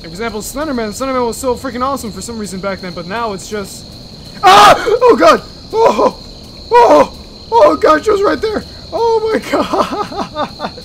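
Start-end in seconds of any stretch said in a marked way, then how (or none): 4.4-4.7 high, very loud startled scream; sudden scream. Real, not acted. Player caught off guard mid-sentence, startled scream. Jump scare interrupts talk. 4.8-10.7 high, loud terrified shouting; voice-cracking panic. Real, not acted. Player after a jump scare, cracking shrieks. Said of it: "Now my voice is cracking".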